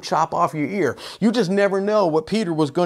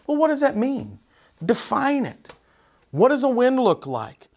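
ordinary delivery

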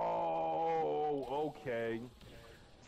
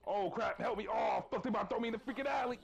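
panicked; 4 semitones higher, faster than usual. Real, not acted. About to be thrown out.

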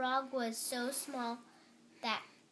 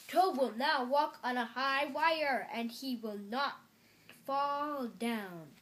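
loud showman, easing off toward the end; strange announcer voice; 6 dB louder than usual. A narrator's reading. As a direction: announce the act to the whole house. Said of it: "said the voice".

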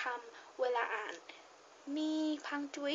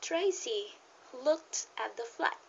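model reading aloud; 4 dB louder, much slower than usual. Shown as a demonstration. Teacher restarts the practice passage for beginners, slow read-aloud. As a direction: read it out clearly and very slowly. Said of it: "เริ่มใหม่นะ".

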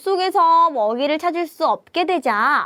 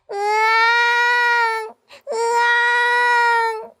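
high, long, held mock wail; baby crying; 9 semitones higher, much slower than usual. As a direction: keep bawling like a baby. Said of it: "울음을 그치지 않았어요". (높고 길게 우는 아기 흉내)

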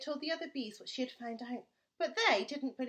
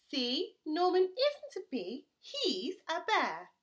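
triumphant reveal; 5 semitones higher, 5 dB louder, slower than usual. Acted. Amelia reveals the bear.

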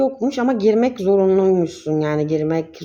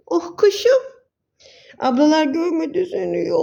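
much higher cooing pet voice; 11 semitones higher than usual. Real, not acted. Cooing to her pet bird for the viewers, high baby talk.

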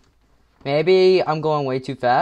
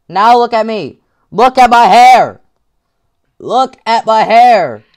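louder, higher, mock-indignant; exasperated; 4 semitones higher, 9 dB louder than usual. Real, not acted. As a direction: show off the mess in outrage. Mock outrage at own state.

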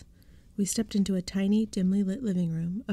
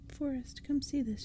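lightly voiced old woman calling, higher; 6 semitones higher, 3 dB quieter than usual. A narrator's reading. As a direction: call him over in a high, soft voice. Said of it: "she called".

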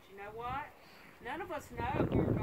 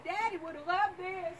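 bright, laughing outburst, pitched up and louder; retold joyful exclaim; 8 semitones higher, 8 dB louder than usual. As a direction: blurt it out happy, almost laughing. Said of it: "I said".

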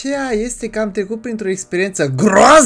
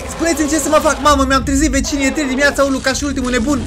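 panicked pleading; 7 semitones higher, faster than usual. Real, not acted. Pleading while chased, woke the neighbours.

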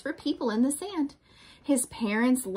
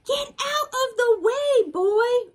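high, urgent storybook command; shouted order; 14 semitones higher, 4 dB louder, slower than usual. A narrator's reading. King ordering Boy out of the battle, high shouted order. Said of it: "ordered the king".

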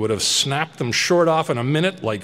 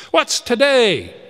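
much higher and louder, slowed, elated call; joyful cry; 12 semitones higher, 8 dB louder than usual. Acted. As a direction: shout down from the window in delight. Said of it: "cried Scrooge".

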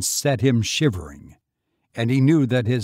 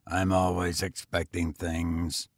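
low, weary tramp; lower, tired vagrant voice; 6 semitones lower, 4 dB quieter than usual. A narrator's reading. Tramp continues.

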